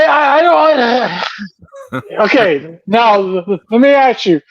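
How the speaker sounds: ordinary delivery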